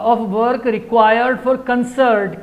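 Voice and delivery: ordinary delivery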